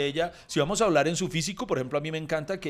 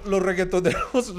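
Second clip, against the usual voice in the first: ordinary talk, nothing marked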